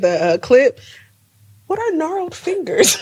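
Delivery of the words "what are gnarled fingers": high, amused disbelief; incredulous laughing question. Real, not acted.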